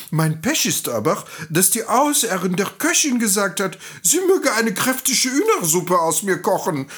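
high, animated rooster voice; indignant rooster. Acted. Doomed rooster explains his crowing to the donkey, agitated. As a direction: announce it high, then lament as the rooster.

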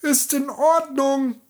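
put-on high, slow old-woman voice; fake-friendly farmer's wife; disguised peasant woman. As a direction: play along sweetly. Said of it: "antwortete die Bäuerin".

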